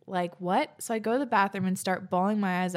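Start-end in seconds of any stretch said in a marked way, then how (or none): none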